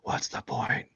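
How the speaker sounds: hushed, breathless whisper of a crushed rival; a jealous artist whispering in mock despair; awestruck despairing whisper. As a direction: whisper it like someone losing all hope. Imitating a crushed jealous viewer.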